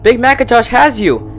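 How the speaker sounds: panicked denial. A narrator's reading. Twilight denies the doll is back, panicked. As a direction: refuse to believe it.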